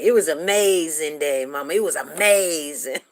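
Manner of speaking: imitating a kid belting out good news; loud, emphatic, punch AMAZING; roaring enthusiasm. Son in trouble answering Mama anyway, roaring enthusiasm. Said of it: "their voice would roar out".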